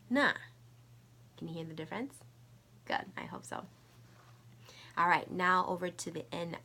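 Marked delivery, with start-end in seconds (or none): 0.1-0.3 "na" short, falling sharply from high; fourth tone. Shown as a demonstration. Teacher showing the four tones, "na" falling. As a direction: drop "na" fast from the top. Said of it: "I'll say the four tones".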